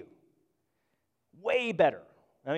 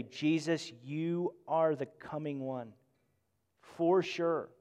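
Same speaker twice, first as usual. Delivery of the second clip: quiet prayer; 7 dB quieter, slower than usual. Shown as a demonstration. Closing eyes in prayer.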